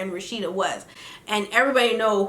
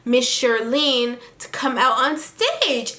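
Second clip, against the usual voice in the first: ordinary talk, nothing marked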